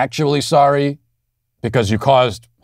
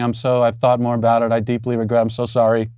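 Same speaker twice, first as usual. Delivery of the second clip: lightly voiced mock-contrite apology. Host parodying a public apology video, understated mock contrition. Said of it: "sit here saying".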